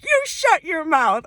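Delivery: high, loud, drawn-out mock astonishment; excited disbelief. Telling viewers about meeting a fellow American, excited disbelief. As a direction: stretch it out in delighted disbelief. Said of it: "I was so excited".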